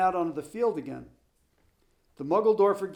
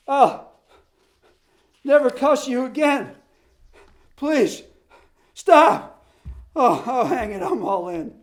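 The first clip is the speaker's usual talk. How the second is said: much higher, much louder gasping plea; breathless begging; 7 semitones higher, 10 dB louder than usual. Acted. Exhausted coach begging Ole to stop, gasping. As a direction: plead with no wind left. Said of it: "gasped Bost".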